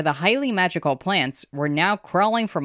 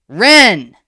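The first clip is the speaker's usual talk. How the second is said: very loud, higher cry; screamed call; 5 semitones higher, 12 dB louder than usual. A narrator's reading. Girl searching for her missing friend, screamed call. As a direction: scream the name across the battle. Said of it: "Sarala screamed".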